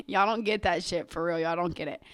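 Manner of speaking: usual speaking voice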